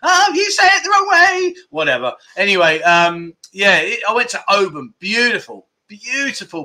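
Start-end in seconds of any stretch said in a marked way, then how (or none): 0.0-1.5 mocking quote. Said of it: "they give me enough grief".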